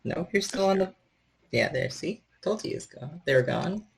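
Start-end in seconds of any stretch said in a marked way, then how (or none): none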